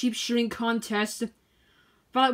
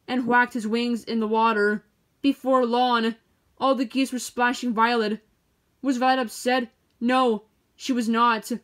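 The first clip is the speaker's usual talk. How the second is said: ordinary delivery, nothing marked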